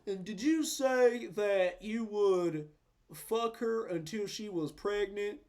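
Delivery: slow, drawn-out deadpan questioning; nonchalant Doctor Phil. Retold or imitated. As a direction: imitate an unfazed TV host, spacing the words out. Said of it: "he's just so nonchalant".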